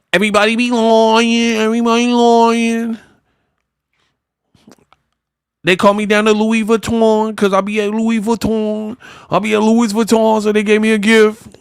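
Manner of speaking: high, droning singsong impression; mock whine. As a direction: stretch each phrase out on one high note. Mocking Clarissa's complaint and boast.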